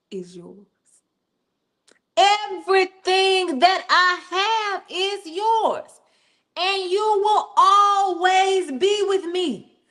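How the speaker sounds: slow, tender promise; reassuring father. Acted. Father reassuring his resentful older son, slow and tender. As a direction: lay it on him gently and slowly.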